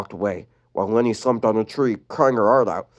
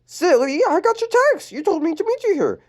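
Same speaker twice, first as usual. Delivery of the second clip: put-on very high girly voice; corrected back to sweet girl; bright high teen girl; Lenny voice. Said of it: "wrong voice".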